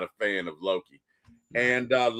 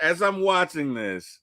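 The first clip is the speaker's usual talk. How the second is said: ordinary delivery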